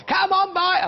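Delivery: hammed-up scream of a desperate seller; mock pushy shout. Shown as a demonstration. Shopping presenter mocking himself, hammed-up hard-sell shout. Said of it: "Don't expect me to scream and shout and go".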